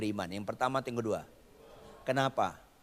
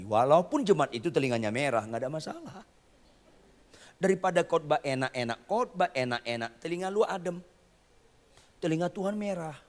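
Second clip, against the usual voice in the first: ordinary delivery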